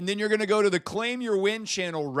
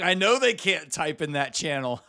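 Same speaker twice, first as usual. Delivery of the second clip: ordinary delivery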